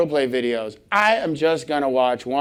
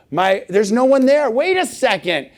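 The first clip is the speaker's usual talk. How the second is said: mock alarm; 7 semitones higher, 4 dB louder than usual. Acts alarm at phantom vibration.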